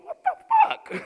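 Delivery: high, loud stunned outburst; shocked. Retold or imitated. Dad stunned by his son's answer, high loud shock. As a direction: blurt it in disbelief. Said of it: "but also".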